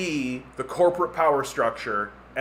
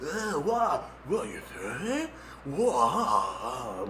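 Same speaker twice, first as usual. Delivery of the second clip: soft, slowed, baffled mumble; Joe Rogan impression; 5 dB quieter, much slower than usual. Joe Rogan talking to a super god, hushed and confused. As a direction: sound lost and awestruck. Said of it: "That's the way Joe Rogan talks".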